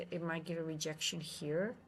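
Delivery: ordinary talk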